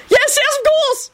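very high, drawn-out squeal; almost shriek it in panic; near shriek. A narrator's reading. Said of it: "he practically shrieked".

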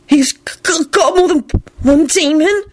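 stammering high-pitched disbelief; incredulous. A narrator's reading. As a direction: stutter it out, unable to believe it. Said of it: "Melvin said incredulously".

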